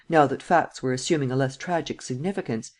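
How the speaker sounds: usual speaking voice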